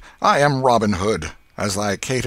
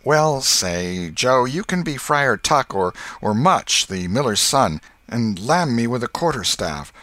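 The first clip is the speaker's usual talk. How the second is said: coaxing; 5 semitones higher, 5 dB louder than usual. A narrator's reading. Tom bargains.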